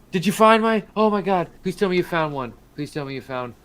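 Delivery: high, urgent gushing plea; frantic gnome. Acted. Gnome begging the party for news of his lost pets, frantic. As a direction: blurt it out high, then ease down.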